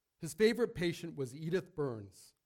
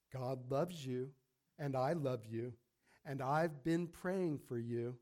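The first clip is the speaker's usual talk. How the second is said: softer, slow and smiling; tender; 3 semitones lower, 4 dB quieter, slower than usual. Acted. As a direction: say it lovingly, with a smile. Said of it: "with a big smile on her face".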